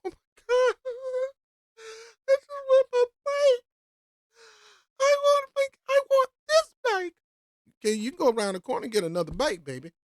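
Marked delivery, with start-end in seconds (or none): 0.0-7.1 much higher, whiny Karen parody; mocking Karen. Commentator replays the bike woman as Karen, high whiny parody. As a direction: whine it like a spoiled woman grabbing the bike. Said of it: "let's try that again, Karen".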